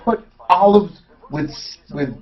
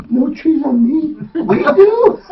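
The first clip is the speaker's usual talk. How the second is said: mock disbelief; 10 semitones higher, much slower than usual. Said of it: "they were like".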